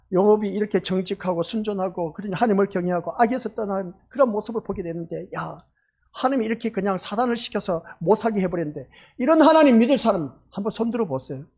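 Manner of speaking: usual speaking voice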